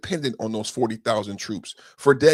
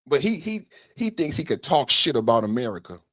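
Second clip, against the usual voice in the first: ordinary talk, nothing marked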